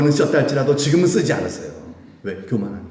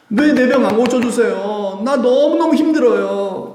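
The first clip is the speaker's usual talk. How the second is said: anguished cry; 11 semitones higher, 6 dB louder, slower than usual. Retold or imitated. Paul crying out to God.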